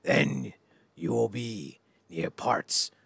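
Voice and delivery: slow, snarling wrestler promo, dropping to unvoiced rasps; Ultimate Warrior. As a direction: growl each phrase like a raving wrestler. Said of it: "That's the Ultimate Warrior snarl".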